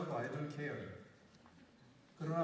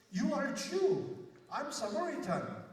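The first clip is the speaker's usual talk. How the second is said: mock Samaritan woman; 5 semitones higher, much slower than usual. Pastor voicing the Samaritan woman mocking Jesus, slow and scornful. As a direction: drag out the words like a dismissive woman. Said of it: "이 여자가 예수님을 보고 하는 말이".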